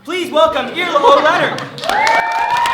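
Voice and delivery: loud, high, drawn-out stage introduction; big rising-energy shout of welcome; announcer welcome. Real, not acted. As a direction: call the guest on to applause, stretching the name. Host announces the guest.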